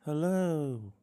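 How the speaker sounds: high bright streamer voice; streamer greeting. Acted.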